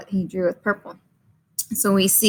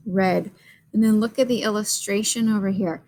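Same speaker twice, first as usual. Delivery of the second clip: usual speaking voice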